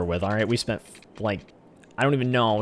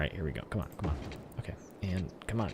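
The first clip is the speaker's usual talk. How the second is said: hush, urging the road to snap; whispered coaxing; 4 semitones lower, 10 dB quieter, faster than usual. Real, not acted. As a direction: whisper come on under your breath. Coaxing the game to connect.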